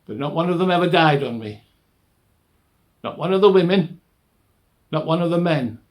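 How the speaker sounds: loud, high-pitched self-defence; vehement protest. Acted. Doctor defending his record with prisoners, loud vehement protest.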